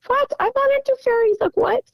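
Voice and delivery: high, quick, incredulous retort; baffled protest. Retold or imitated. Dog owner answers the fairy claim, incredulous. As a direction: protest in a squeaky-high, disbelieving voice. Retells her baffled reply about fairies.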